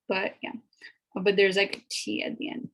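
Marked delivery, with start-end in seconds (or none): none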